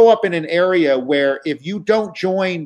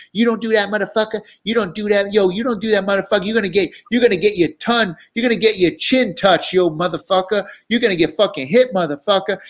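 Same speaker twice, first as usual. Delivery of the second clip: higher, heated mock threat from the rapper; threatening him for the joke, imitated in a raised voice; imitated furious threat; 6 semitones higher than usual. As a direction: play the angry crew member, pitched up and heated. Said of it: "one of them's furious".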